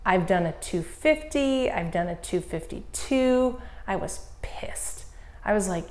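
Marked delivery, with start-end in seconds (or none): 3.9-5.0 hushed, slow whisper; a slow, bitter whisper; whispered anger. Retold or imitated. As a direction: whisper it like you're still fuming. Angry recalling bad bike time.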